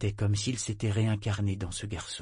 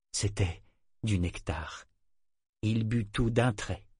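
plain reading voice

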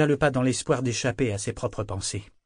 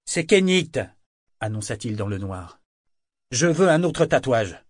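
loud calling out; 6 dB louder than usual. Acted. Kenit calling into the dark house, loud call. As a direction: announce yourself through a shut door at night.

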